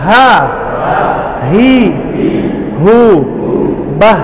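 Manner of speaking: Ha, hi, hu, bah on a thin airy h; thin breathy ha. Shown as a demonstration. Teacher showing the class a thin breathy ha drill. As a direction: let the air out on each Ha, hi, hu, bah. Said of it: "Ha juga sama".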